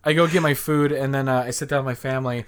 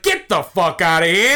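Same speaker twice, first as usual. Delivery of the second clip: raised, higher mock yell; imitated Australian outburst; 5 semitones higher, 4 dB louder than usual. Australian player after missing his shot, mock yell. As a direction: exclaim it like the retold Aussie player.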